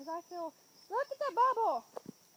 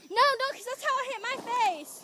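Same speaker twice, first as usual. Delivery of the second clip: loud; 5 semitones higher, 8 dB louder than usual. Real, not acted. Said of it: "shouting warning as she demonstrates".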